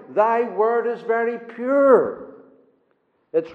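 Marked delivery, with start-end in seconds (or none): 0.1-2.3 "Thy word is very pure" drawn out very slowly, each word weighed; slow reading. Shown as a demonstration. Preacher reading the verse to his congregation, slowly. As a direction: read "Thy word is very pure" at half speed. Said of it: "Verse a hundred and forty".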